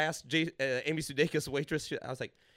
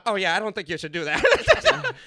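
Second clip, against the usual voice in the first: slow, louder, laughing through it; waitress half-laughing as she warns him, slow and louder; laughing warning; 2 semitones higher, 9 dB louder, slower than usual. Retold or imitated. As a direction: chuckle while warning him off. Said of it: "she's like".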